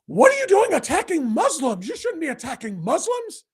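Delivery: high-pitched mock protest; mocking, indignant quote pitched well up; Tucker Carlson. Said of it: "Tucker Carlson saying".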